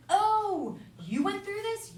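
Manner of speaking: high-pitched retold astonishment; surprised discovery. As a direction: act out suddenly learning a friend's secret. Acts out surprise at friends' stories.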